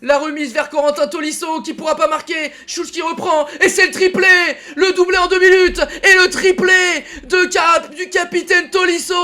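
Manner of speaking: laughing shouted goal call; excited sports commentary. Real, not acted. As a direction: call the hat-trick with delight. Commentator calling a hat-trick.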